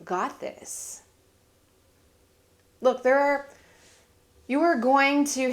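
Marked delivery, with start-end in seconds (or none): 0.0-1.0 slow, softer, hushed self-encouragement; soft whisper to yourself. Shown as a demonstration. Telling herself she can do it, slow hushed reassurance. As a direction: breathe it out gently to yourself. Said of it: "whisper to yourself".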